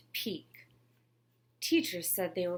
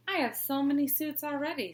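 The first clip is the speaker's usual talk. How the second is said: higher, louder protest; a hint of a fussy principal; brushing off yet another suit; lightly voiced principal; 7 semitones higher, 4 dB louder, faster than usual.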